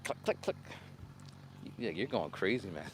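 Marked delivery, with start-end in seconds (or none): 0.0-0.6 soft, sharp insect clicks; imitated clicking. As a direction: click like an agitated cicada. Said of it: "it was clicking like".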